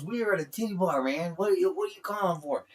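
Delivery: high, lively phone voice. Retold or imitated. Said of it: "[name] looked at me".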